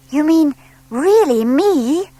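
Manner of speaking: high, slow, wide-eyed owlet voice; a small owl hardly believing it; lightly voiced owlet, amazed; amazed little owl. A narrator's reading.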